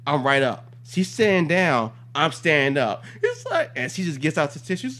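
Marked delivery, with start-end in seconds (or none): none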